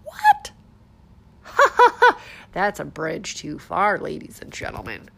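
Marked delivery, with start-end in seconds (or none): 0.0-0.5 high squeaky fake gasp of what; mock shock. Real, not acted. 1.5-2.5 high loud burst of laughter. Real, not acted. Laughs after mock shock.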